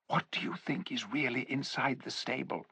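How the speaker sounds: soft hushed question; whispering. A narrator's reading. Jill whispering into Tyrion's ear, soft and hushed. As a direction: whisper it close to his ear. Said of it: "trying to whisper in his ear".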